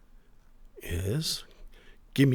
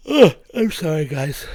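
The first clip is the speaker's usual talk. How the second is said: yawning; 3 semitones higher, 7 dB louder than usual. Real, not acted. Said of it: "Yawning on mic".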